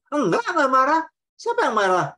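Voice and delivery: loud, high, snapping denial of being angry; sulky partner insists she isn't angry, openly angry. Retold or imitated. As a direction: deny it while clearly furious. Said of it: "kata-katanya tidak marah, tapi nada suara".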